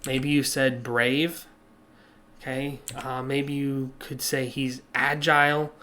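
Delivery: usual speaking voice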